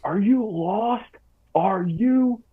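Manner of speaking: high, drawn-out imitation of a screaming drill sergeant; mock a drill sergeant's scream, stretched out, not at full volume. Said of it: "he's just screaming at me".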